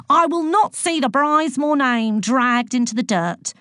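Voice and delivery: high, indignant rant; outraged teacher laying down a verdict; screaming teacher. A narrator's reading. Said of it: "screamed Boyle".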